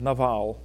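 Hebrew-style Naval, no long A; Hebrew pronunciation. Shown as a demonstration. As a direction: Naval with open ah sounds, stressed on the last syllable. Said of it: "Hebrew doesn't have a long A sound".

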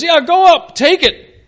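loud, high mock cheerleading; dripping sarcasm. Micaiah telling the king to go to battle, sarcastic. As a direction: egg the king on with overblown false encouragement. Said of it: "this thing is dripping with sarcasm".

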